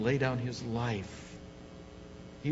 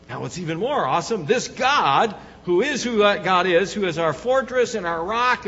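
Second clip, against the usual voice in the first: loud, rising preacher's voice; full-force preaching; 5 semitones higher, 8 dB louder than usual. Acted. Pastor rousing the congregation, full-force preaching. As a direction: preach it up to the rafters.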